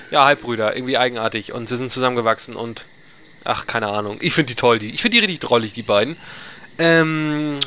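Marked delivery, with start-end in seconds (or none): none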